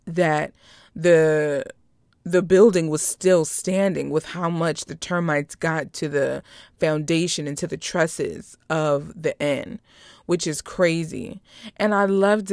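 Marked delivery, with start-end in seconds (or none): none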